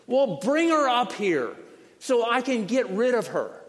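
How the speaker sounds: loud, high, slowed exasperated order; fed-up judge. Acted. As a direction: give in with impatient force.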